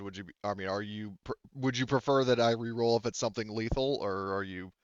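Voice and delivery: normal talking voice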